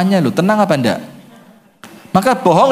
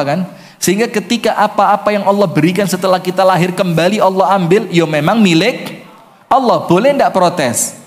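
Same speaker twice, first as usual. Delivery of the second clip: normal talking voice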